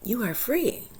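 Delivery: soft whisper, tender; aunt whispering freedom to her niece. Retold or imitated. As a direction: hush it like a secret blessing. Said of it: "I said".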